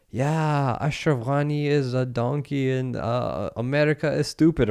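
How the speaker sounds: a hint of halting non-native English; broken English. Taliban guards trying English on the dad, lightly mocked broken English. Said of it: "trying to speak English to me".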